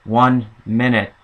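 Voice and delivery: slightly robotic recorded voice, slowed down; kinda robotic. Retold or imitated. Another voice cutting into the Metra announcement, mildly robotic. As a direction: lightly imitate an automated announcement, word by word. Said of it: "it's kinda robotic".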